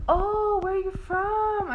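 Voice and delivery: high, drawn-out surprised "where are you from?"; playing the lady caught off guard, stretched out; mock surprise. Lady's surprised question voiced high.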